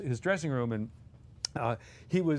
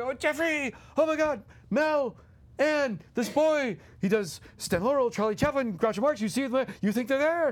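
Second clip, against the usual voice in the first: gushing excitement; 9 semitones higher than usual. Retold or imitated. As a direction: rave about him to friends. Dom greeting excitedly at festival.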